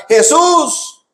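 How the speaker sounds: very high, drawn-out hailing call; acted yell to get his attention; imitated crowd shouting. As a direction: holler like someone in a crowd wanting favours. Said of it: "y, y gritaban".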